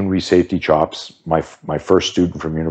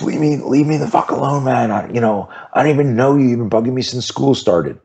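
put-on upset teenager; 7 semitones higher than usual. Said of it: "Mitch goes".

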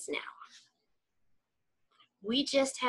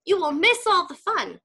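much higher, louder plea; crying out; 6 semitones higher, 4 dB louder than usual. Acted. Scene: Frog begs Toad not to go to bed, loud. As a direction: call him back before he leaves. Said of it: "cried Frog".

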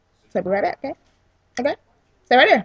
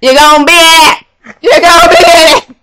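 loud, higher-pitched insistence; playful insistent yell; 4 semitones higher, 9 dB louder than usual. Real, not acted. Sister insisting her sibling join the video, insistent yell. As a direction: yell it out at full volume.

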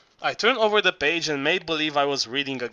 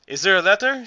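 a worried, louder and higher question; anxious; 5 semitones higher, 5 dB louder, faster than usual. A narrator's reading. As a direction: ask it anxiously, pressing for news. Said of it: "she asked anxiously".

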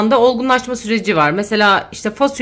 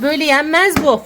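ordinary talk, nothing marked

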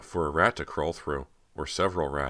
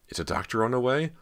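panicked question; 8 semitones higher, 3 dB louder, faster than usual. A narrator's reading. Panicked guests shouting.

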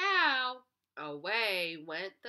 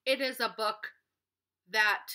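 plain reading voice

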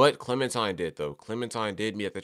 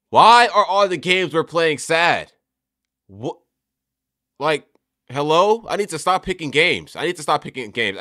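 louder, higher cry of exasperation; exasperated outburst; 6 semitones higher, 10 dB louder than usual. Real, not acted. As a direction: complain loudly at the unfairness of it all. Exasperated over yet another sad game.

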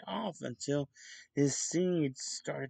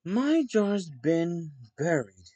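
haughty; 4 semitones higher, 7 dB louder than usual. A narrator's reading. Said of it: "exclaimed Pennington haughtily".

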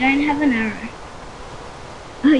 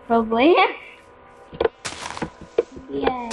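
ordinary talk, nothing marked

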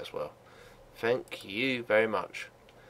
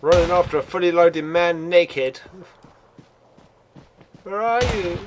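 higher and louder, hyped up mid-chase; excited chase shout; 6 semitones higher, 7 dB louder than usual. Real, not acted. Gamer chasing an armed player, excited shouting. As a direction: yell it while charging after him.

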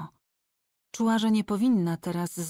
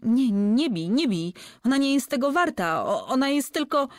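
higher, louder terrified cries; screaming plea; 6 semitones higher, 4 dB louder than usual. A narrator's reading. Heming screams at Tengel not to hit him. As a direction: scream for him to stop hitting. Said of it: "chłopak powtarzał krzycząc".

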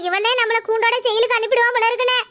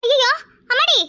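fainting cry; 5 semitones higher than usual. Acted. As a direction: cry out as the strength leaves you. Collapsing woman cries out.

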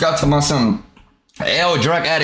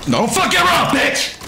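high, raised-pitch holler; heated shout; 7 semitones higher than usual. Real, not acted. Calling out during tense game play.

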